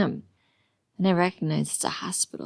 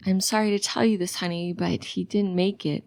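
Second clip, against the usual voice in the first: usual speaking voice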